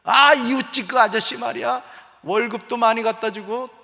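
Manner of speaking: shrill-free mock complaint about the neighbor husband; comparing nagging wife. Preacher imitating a wife comparing her husband to the neighbor, mock nagging. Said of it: "아내들이 정말 실수하는 게 이거야".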